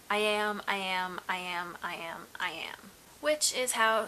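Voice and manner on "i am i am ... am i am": "I am" with the weight on "am", fading to a whisper; iambic stress demo. Shown as a demonstration. Showing viewers iambic pentameter, stressing "am" and fading out. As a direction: tap out "I am, I am" with the stress on "am". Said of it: "the emphasis on the second syllable".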